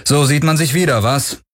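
high, cool greeting; without cordiality. A narrator's reading. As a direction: greet an old acquaintance with little cordiality. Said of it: "rief Galen ohne große Herzlichkeit".

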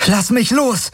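sharp high angry command; snapping. A narrator's reading. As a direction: bark it at him in fury. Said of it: "herrschte sie ihn an".

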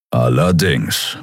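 slow, low grumble; grumbling. A narrator's reading. Keru agreeing he will need the key, grumbling. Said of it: "brummte er".

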